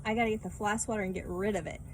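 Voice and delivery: usual speaking voice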